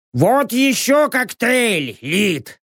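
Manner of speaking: high, slow serving voice; voiced bar server. A narrator's reading. Server brings Lid another cocktail, high character voice. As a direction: hand over a drink in a high character voice.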